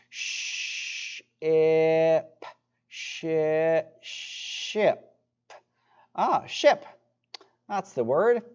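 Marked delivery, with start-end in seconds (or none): 0.1-5.0 sounds stretched apart, then blended slowly; drawn out vowels. Shown as a demonstration. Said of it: "let's sound it out together".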